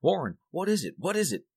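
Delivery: high, frantic repeated question; terrified. A narrator's reading. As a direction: cry out in terror, high-pitched. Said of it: "Terrified, I continued to repeat".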